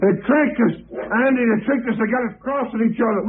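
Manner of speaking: high, urgent panic at being double-crossed; frantic. Acted. Cornered man to his partner, frantic and high. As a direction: blurt it out as the trap closes. Trapped men turn on each other.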